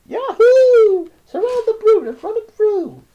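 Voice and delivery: very high, very loud war cry; screaming. A narrator's reading. French crowd charges with knives, very loud. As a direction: whoop like a charging mob. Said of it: "They screamed".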